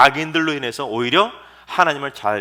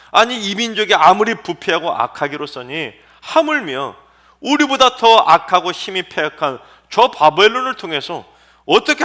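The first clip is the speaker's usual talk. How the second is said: a mild acted protest, louder and higher; protesting God; 4 semitones higher, 6 dB louder than usual. Preacher voicing Habakkuk arguing with God, mildly indignant. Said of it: "하나님께 뭐라고 따졌냐면".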